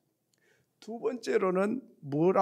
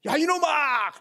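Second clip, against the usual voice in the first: high, loud scolding yell; loud hilltop cursing; angry shout; 8 semitones higher, 8 dB louder than usual. Retold or imitated. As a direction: bellow it at the hidden copycat. Child curses at the echo.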